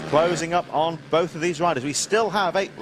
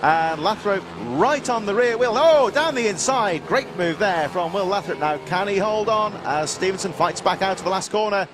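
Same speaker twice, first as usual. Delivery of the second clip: excited commentator; 5 semitones higher than usual. Real, not acted. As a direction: call the overtake live with rising excitement. Overtake on the inside, live call.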